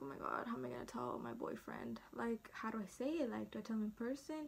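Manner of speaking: soft, retold fretting; worried self-talk. Woman wonders how to tell boyfriend, quietly panicking. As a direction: fret quietly, as if to yourself.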